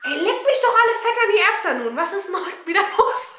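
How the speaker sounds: loud, high, put-on yell; exasperated outburst. Real, not acted. Shouting at the cat who keeps interrupting, high exasperated yell. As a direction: blow up at the interruption in a mock voice.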